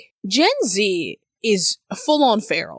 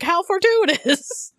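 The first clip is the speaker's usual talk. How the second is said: laughing mock-posh; 9 semitones higher, slower than usual. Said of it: "we were like".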